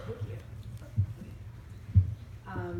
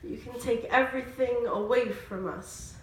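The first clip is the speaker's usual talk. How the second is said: higher, rhythmic spoken word delivery; spoken word poetry; 6 semitones higher, 8 dB louder than usual. Acted. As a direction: perform it as an emphatic poem. Performs a spoken word poem.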